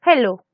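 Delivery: "hello" as a Spanish-speaking beginner says it; learner's Spanish-sounding "hello"; learner's mispronunciation. Shown as a demonstration. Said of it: "pronuncian algunos como hello".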